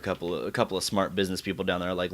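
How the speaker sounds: ordinary delivery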